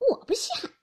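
high, dismissive little huff; haughty refusal. A narrator's reading. Daiyu turns away from the offered gift, haughty refusal. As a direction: turn it down with disdain. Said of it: "林黛玉将头一扭".

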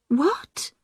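a sharp, breathless whispered What; shocked whisper. A narrator's reading. Sister hearing the tablets are missing, shocked whisper. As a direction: whisper it in disbelief.

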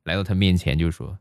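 normal talking voice

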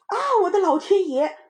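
high, loud alarmed mother; shocked cry. A narrator's reading. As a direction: exclaim in horror at the children. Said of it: "就叫了起来". (妈妈惊叫)

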